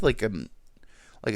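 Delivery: ordinary talk, nothing marked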